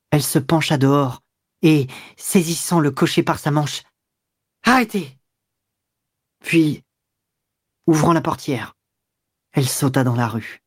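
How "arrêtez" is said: high, sudden call to halt; ordre crié. A narrator's reading. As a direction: call out urgently to the driver. Ordering the coachman to stop. (cri aigu pour faire arrêter)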